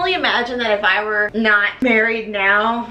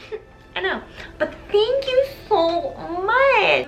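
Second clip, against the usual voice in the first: Christine; 6 semitones higher than usual. Acted. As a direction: stretch out the mock-modest thanks. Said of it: "And she's like".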